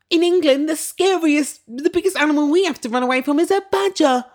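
very high, loud mock English accent; fake English accent. Mocking the woman's badger remark, shrieky fake English accent. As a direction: exaggerate a dodgy hybrid English accent. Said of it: "This is not an English accent".

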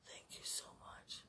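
barely audible whisper. Real, not acted. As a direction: whisper your thanks.

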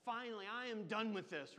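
put-on high, loud little-girl outburst; little sister fed up with being ignored, yelling. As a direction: throw up your hands and yell. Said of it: "She just yelled".

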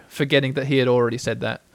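plain reading voice

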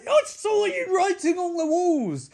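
very high-pitched outburst; mock outraged accusation. Acted shouted accusation in quarrel.

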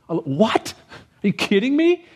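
high, shocked disbelief; mock outrage, pitch jumping high; incredulous Paul. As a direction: throw up your hands in disbelief. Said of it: "That's his response here".

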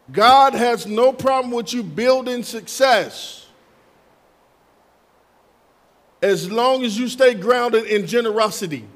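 loud, emphatic sermon delivery; full-force preaching. Real, not acted. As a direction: proclaim it to the whole congregation.

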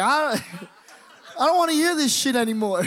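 high, loud hammed-up freak-out with laughter; panicked outburst. Teen slamming the flip phone shut, high mock-panicked outburst. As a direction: shriek it as a comic refusal.

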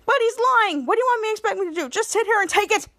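high, rushed, indignant protest; freaking out. Retold or imitated. Ray protests to Schwoz about the lies, freaking out. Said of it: "Ray was already freaking out".

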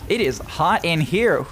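ordinary talk

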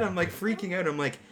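ordinary delivery

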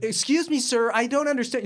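high-pitched polite bewilderment; imitating a baffled caller; confused passenger. Acting confused passengers in retold skit.

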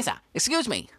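usual speaking voice

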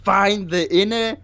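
high, slow ladylike voice; ladylike old aunt. Acted. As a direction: coo like a genteel old lady. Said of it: "ladylike when you portray Aunt Tom".

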